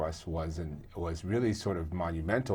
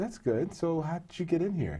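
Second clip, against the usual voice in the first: mock surprised reply; 12 semitones higher than usual. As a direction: replay the surprise, pitched well up. Retelling his own surprised reply.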